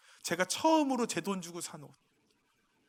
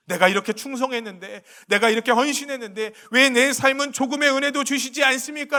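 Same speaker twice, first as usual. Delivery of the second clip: mock complaint; 9 semitones higher, 11 dB louder than usual. Voicing a believer's complaint.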